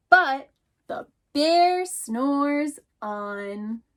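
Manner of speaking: drawn-out, very slow refrain, leaning on bear. Acted. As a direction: stretch every word of the refrain. Refrain in picture book.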